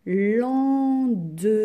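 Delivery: lendemain stretched loudly, every syllable held; syllable-by-syllable dictation. Shown as a demonstration. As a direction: draw out lendemain as lan, de, main. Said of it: "Combien de syllabes dans lendemain?".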